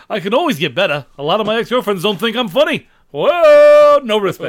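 loud nervous comic, rising to a yelp; Rodney Dangerfield. Acted. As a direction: belt the punchline Dangerfield style. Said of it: "do a Dane Cook routine as Rodney Dangerfield".

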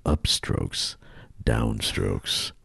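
low, lingering mock-sultry list; slow seduction for the ladies. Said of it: "read this a little slower for the ladies".

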